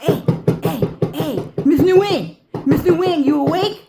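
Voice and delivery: yelling to wake Miss Nguyen; loud, high, needy shout, rushed at the end; calling out loud. Acted.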